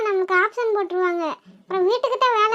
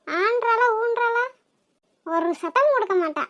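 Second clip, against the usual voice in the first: ordinary talk, nothing marked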